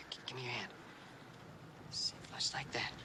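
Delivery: soft whisper. Real, not acted. Teacher coaxes the girl closer, whispering softly. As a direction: coax her in a hushed whisper. Coaxing a child gently.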